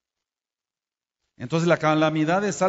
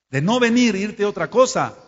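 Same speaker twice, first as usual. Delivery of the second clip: scolding call-out; 5 semitones higher, 6 dB louder than usual. Real, not acted. Scolding those who skipped the feast.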